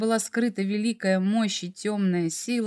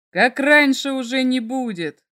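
loud furious outcry; shouting; 5 semitones higher, 5 dB louder than usual. A narrator's reading. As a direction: shout it out at him. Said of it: "прокричал дракон".

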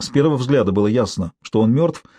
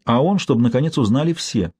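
plain reading voice

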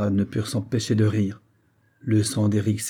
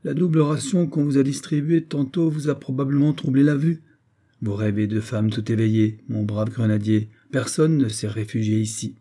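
usual speaking voice

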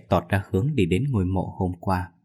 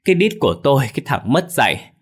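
high, loud cry of pain and outrage; pained angry shout; groaning yell; 5 semitones higher, 4 dB louder than usual. Acted. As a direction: yell at the clumsy thug, hurt and furious. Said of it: "đang nằm la rên rỉ".

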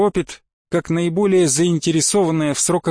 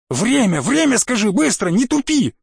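high-pitched, impatient demand climbing; frantic, agitated insistence; alarmed urgency; 7 semitones higher than usual. Acted. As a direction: press for the time in rising panic. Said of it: "Спросил беспокойно с какой-то необыкновенной тревогой".

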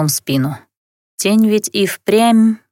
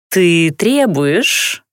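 slow, sneering question; disdainful; 2 semitones lower, much slower than usual. A narrator's reading. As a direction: draw it out with contempt. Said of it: "Лорданен скривилась".

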